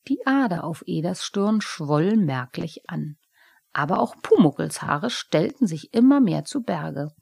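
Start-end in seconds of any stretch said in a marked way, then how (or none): none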